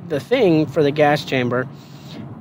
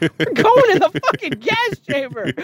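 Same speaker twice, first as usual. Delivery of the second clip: retold panicky exasperation. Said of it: "I'm like".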